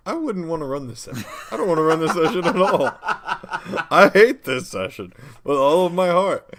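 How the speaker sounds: high, loud exasperation through laughter; laughing rant. Real, not acted. Joking rant against the session, laughing.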